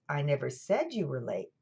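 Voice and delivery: "said" punched with a pitch jump; stress on SAID. Shown as a demonstration. Lecturer showing how emphasis changes meaning, stress on "said". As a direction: hit the word "said", rest unstressed. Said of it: "which word you emphasize".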